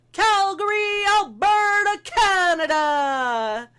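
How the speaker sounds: booming, drawn-out ring announcer call; wrestling announcer. Pretend. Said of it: "I was gonna try to do my best wrestling impression".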